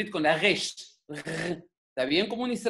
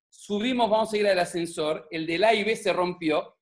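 ordinary delivery

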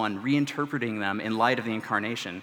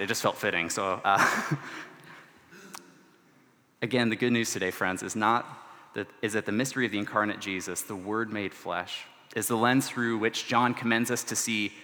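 ordinary delivery

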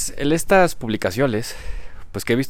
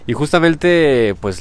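usual speaking voice

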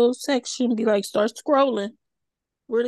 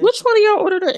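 accusing; 10 semitones higher, 6 dB louder, faster than usual. Retold or imitated. Retold accusing question to kids.

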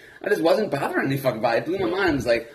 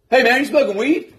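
startled stranger's call; 9 semitones higher, 6 dB louder than usual. Retold or imitated. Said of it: "and this guy's like".

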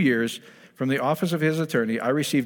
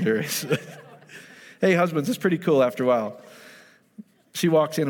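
ordinary talk

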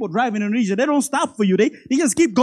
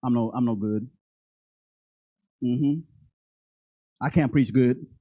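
low, mumbled self-talk of doubt; self-doubting inner voice; 11 semitones lower, 7 dB quieter than usual. Acted. Preacher voices his own doubting inner voice, low. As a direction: sink into a mopey inner voice. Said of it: "let me tell you about my internal voice".

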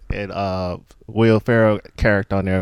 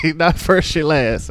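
louder, higher hyped-up quote; 7 semitones higher, 5 dB louder than usual. Retold or imitated. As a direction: shout it like a pumped racer. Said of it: "Do you have some favorite quotes".

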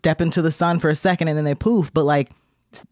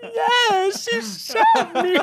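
very high, very slow, breaking into laughter; laughing mock reply; much slower than usual. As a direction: drag it out high while cracking up. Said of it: "she's like".

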